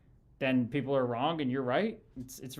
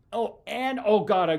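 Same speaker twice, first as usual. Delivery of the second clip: sudden realization; 6 semitones higher, 6 dB louder than usual. Real, not acted. Suddenly remembers a missed point.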